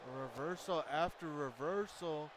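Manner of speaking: excited wrestling commentary; live play-by-play. Acted. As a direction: call the match like a ringside announcer.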